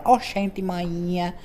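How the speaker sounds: mock Bahian accent, lightly done; a light imitation of a Bahian accent. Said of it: "na Bahia, fala".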